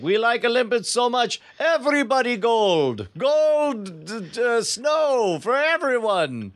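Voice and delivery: lightly put-on Russian official voice; a touch of a Russian bureaucrat; Russian accent. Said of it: "put out a press release like".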